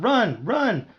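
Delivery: high, urgent cry to flee; urgent warning. Acted. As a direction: urge him to get away. Narrator's alarmed thought, urging escape.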